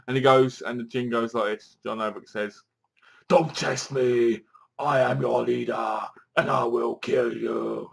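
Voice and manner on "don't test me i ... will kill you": menacing villain. Retold or imitated. Voicing the villain Jin threatening.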